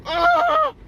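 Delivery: huge high, loud oh of disbelief; a shocked, indignant cry; scandalised yelp; outraged oh. Real, not acted. Reacting to twenty percent off.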